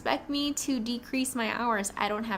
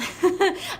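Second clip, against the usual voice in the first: a quick, high, bright laugh; real laugh; 9 semitones higher, 7 dB louder than usual. Real, not acted. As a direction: laugh out loud at yourself, loudly and up high. Bursts out laughing mid-ramble.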